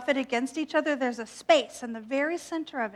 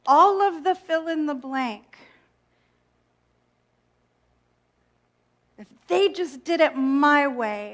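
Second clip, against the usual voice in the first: mock self-righteous; 3 semitones higher, 4 dB louder, slower than usual. Imitating her own internal trigger, self-righteous and judgmental. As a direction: look down your nose at them. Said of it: "When I start saying things like".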